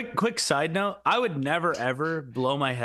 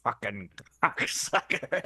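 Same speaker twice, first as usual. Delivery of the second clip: low, slow snarl through gritted teeth; mock snarl; 7 semitones lower, much slower than usual. Angry old customer cursing the repairman, slow gritted snarl. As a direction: spit it slowly through clenched teeth. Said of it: "kinda gritting his teeth".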